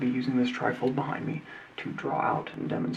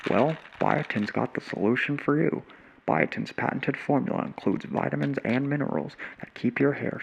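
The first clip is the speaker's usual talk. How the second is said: slick TV ad voiceover; commercial announcer. Acted. TV ad announcer pitching hair vitamins, upbeat sell. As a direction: sell it like a late-night commercial.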